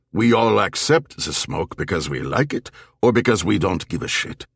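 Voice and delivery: put-on thick German accent, halting English; old German barfly, philosophising. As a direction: muse aloud in uneven, German-accented English.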